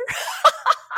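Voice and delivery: high, airy burst of real laughter. Real, not acted. Mother breaks into laughter remembering her son's Russian accents. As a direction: laugh out loud at the memory. Recalling laughing fit with son.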